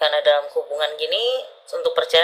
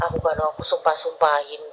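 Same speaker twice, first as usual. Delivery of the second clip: normal talking voice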